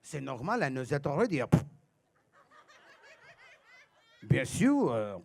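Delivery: put-on low, soft French-accent "I know that already"; bored French indignance; French guy. Comedian pretending to be a Frenchman, low French accent. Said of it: "pretend I'm a French guy".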